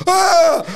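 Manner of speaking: high startled yelp, imitated; startled scream. Imitating the roommate's scream when snuck up on. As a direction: shriek like you got jumped from behind. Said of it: "Er so".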